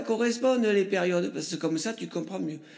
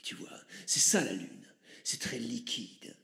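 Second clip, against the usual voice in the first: low, hushed mystic guru; a solemn shaman murmuring his teachings, lower and softer; deep breathy new-age mystic, lower and softer; Chaman Chorizo; 5 semitones lower, 6 dB quieter than usual. Acted. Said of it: "je vais te laisser avec, euh, Chaman Chorizo".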